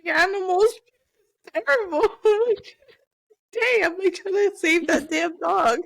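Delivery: high, loud, laughing exasperation; exasperated mock quote. Mother mimics herself about the dog, high and exasperated. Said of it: "You're like".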